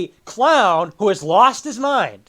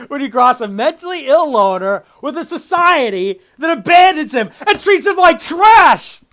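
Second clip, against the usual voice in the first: loud, high, theatrical villain rant; hammed-up, impassioned movie monologue; Joker; 5 semitones higher, 7 dB louder than usual. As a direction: build from a taunting joke to an outraged shout. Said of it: "and then Joker says".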